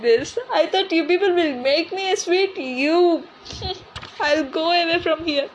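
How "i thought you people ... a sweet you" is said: normal talking voice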